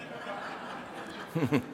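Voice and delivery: quiet breathy chuckle; soft laugh. Real, not acted. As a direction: chuckle softly. Laughing at own remark.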